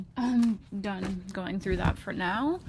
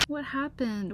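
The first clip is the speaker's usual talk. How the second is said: normal talking voice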